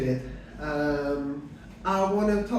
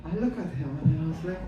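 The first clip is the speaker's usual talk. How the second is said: ordinary talk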